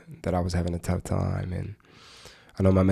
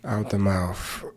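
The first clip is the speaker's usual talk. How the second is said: unhurried meditation guide, long pauses; measured, even meditation voice with spacious silences; guided meditation. Acted. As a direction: guide listeners gently into stillness, phrase by phrase.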